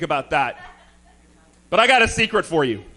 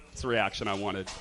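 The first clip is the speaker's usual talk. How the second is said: normal talking voice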